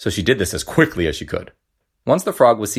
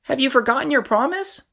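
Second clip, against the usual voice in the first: high, small frog voice; squeaky storybook frog; little frog voice. A narrator's reading. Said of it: "called the frog".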